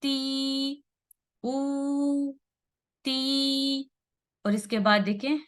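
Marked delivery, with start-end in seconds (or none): 0.0-3.9 "ती" and "ऊ" each held long, higher, on a level note; two-count vowels. Shown as a demonstration. Teacher drilling two-harakat stretches, long held vowels. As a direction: chant "ती", "ऊ", "ती" slowly, one sustained syllable each. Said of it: "इसको दो हरकत जितना लंबा".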